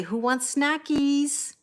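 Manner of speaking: high, drawn-out treat call; calling the cats. Shown as a demonstration. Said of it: "If I say".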